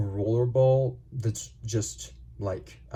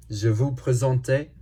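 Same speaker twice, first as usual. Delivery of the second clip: slow, slightly raised French presentation; mock-grand French; 3 semitones higher, 5 dB louder, slower than usual. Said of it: "I'm speaking in French".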